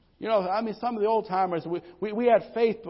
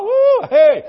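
high, very loud whoop and hey; joyful whoops; 14 dB louder than usual. Real, not acted. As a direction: shout for joy. Joyful preacher shouts.

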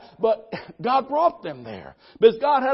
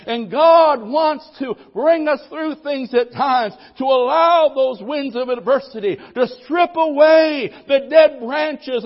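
preaching louder and louder, slowing down; fiery preaching; 4 semitones higher, 5 dB louder than usual. Acted. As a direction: build the sermon to full force. Preacher building to full force.

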